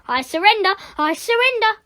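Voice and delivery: high, loud panicky plea; gulped surrender. Acted. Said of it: "gulped Nick".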